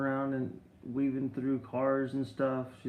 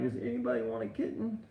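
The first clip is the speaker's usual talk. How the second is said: laughing plea; 10 semitones higher than usual. Real, not acted. Begging everyone to take kitten.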